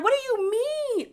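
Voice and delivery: high-pitched mock indignation; imitated angry outburst. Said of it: "her accent popped out".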